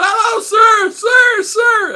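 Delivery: high, loud falsetto calls of sir; imitated woman calling out. Women at the airport walkway hailing the arriving American, high loud calls. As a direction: call out to a stranger in a high woman's voice.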